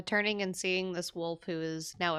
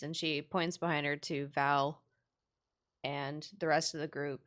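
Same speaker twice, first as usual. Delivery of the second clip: usual speaking voice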